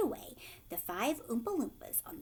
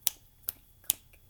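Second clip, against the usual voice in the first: ordinary delivery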